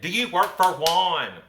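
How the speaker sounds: slow, high shout, word by word; very loud repeating. Retold or imitated. Mother repeating herself to a baffled man, very loud. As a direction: bellow it slowly at someone who can't understand. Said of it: "repeating very loudly".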